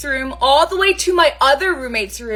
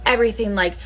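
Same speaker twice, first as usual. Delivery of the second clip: ordinary delivery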